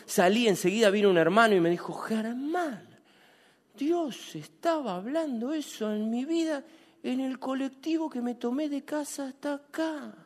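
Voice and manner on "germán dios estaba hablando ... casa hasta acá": soft retold brother. Brother tells him after service.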